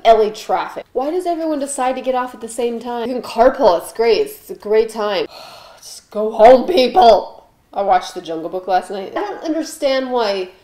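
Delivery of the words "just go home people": loud, slow fed-up plea; exasperated. Real, not acted. Fed up with LA traffic, loud exasperated plea. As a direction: sigh then shout it at the traffic.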